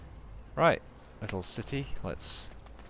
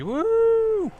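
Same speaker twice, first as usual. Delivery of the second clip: loud high whoop of excitement; excited whoop; 10 dB louder than usual. Real, not acted. Gamer hyping viewers with a loud whoop. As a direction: cheer it out to kick things off. Hyped cheer at episode start.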